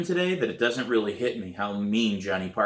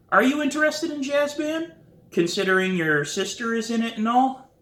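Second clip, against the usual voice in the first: much higher, bright questioning lift; eager kid asking; 13 semitones higher, 4 dB louder than usual. A narrator's reading. Sam to Scott, changing the subject, high and eager. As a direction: pitch it high like a curious kid. Narrator voices herself asking friend.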